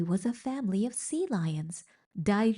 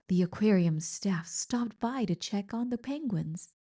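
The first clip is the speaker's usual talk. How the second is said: ordinary delivery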